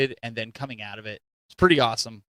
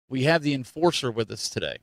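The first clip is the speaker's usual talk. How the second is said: ordinary talk, nothing marked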